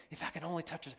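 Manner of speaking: soft, inward voicing of her hope; whispered longing. Preacher voicing the sick woman's thought, softly. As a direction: say it to yourself, hushed and yearning.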